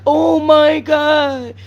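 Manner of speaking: slow, drawn-out mock wail; imitating crying. Vlogger mocking the crying woman, slow mock wail. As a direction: mimic her crying oh my God. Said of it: "this woman was really sitting here crying like".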